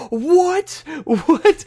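high shocked disbelief; surprised. Retold or imitated. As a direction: squeal it out in disbelief. Shocked at shiny sparkle.

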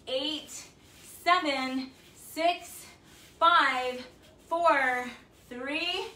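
loud, bright rep count with upward lifts; energetic workout countdown; coach counting reps. Acted. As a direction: call the count out like a fitness coach.

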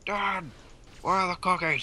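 yelling across the room. Pretend. Calling to a parent elsewhere.

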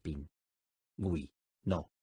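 "Pin. Gui. No." each syllable spoken apart with a pause. Shown as a demonstration. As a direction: split "Pingüino" into separate syllables. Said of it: "dila silábicamente conmigo". (separando sílabas: "Pin. Gui. No.")

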